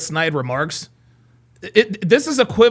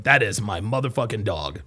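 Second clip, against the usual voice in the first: ordinary delivery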